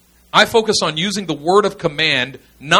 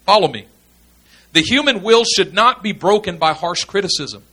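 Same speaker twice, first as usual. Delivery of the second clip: normal talking voice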